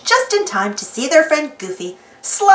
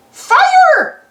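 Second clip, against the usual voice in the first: high, loud duck squawk; panicked quacking cry for help; squawking Donald; 6 dB louder than usual. Acted. Donald's float is on fire: high panicked squawk. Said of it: "squawked Donald".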